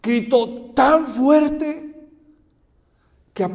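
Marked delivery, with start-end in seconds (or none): 0.0-2.1 "tan fuerte" loud, high and stretched out; emphatic stress. Real, not acted. Preacher telling how Bartimeo shouted, emphatic "tan fuerte". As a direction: lean hard and slowly on "tan fuerte".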